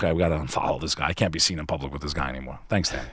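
low, quick, quiet aside; mock dismissal. Imagined viewers quietly dropping the host after the episode, mock dismissal. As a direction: voice imagined viewers backing off under their breath.

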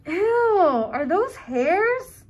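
high, loud grossed-out cry; disgusted. Acted. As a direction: recoil at the sight. Kids inside a nose.

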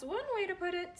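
put-on dry little-boy remark; Wilson voice.

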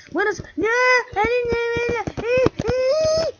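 high panicked no, no; panicked cries. Real, not acted. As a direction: squeal in real fright at the game. Panicking at spider in game. (gritos agudos de pánico)

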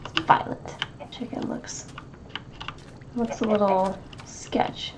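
quiet muttered whisper. Real, not acted. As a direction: mutter it under your breath. Aside while playing.

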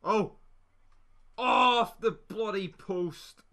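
high, loud, slow groan of disbelief; frustrated cry. Real, not acted. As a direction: cry out as it hits the post. Shot hits the post.

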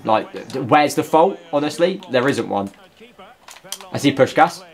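ordinary talk, nothing marked

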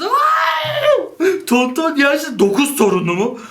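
high laughing shout of disbelief; shocked laughing. Real, not acted. Reading a quiz result about nine grandchildren, shocked laughing yell.

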